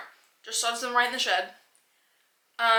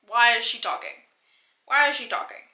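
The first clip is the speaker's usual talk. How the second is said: exasperated demand; 7 dB louder than usual. Retold or imitated. Outraged reaction to the character talking.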